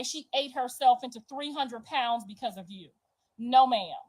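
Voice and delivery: usual speaking voice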